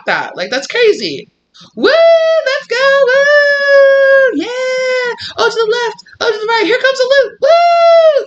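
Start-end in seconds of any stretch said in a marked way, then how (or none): 1.8-8.2 high, loud cheering woos; roller coaster screams. Pretend. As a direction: scream with joy on the ride. Acting out roller coaster ride.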